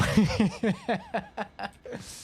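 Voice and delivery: amused laugh. Real, not acted. Streamer breaking after the joke voice, real laughter. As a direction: crack up after a silly bit.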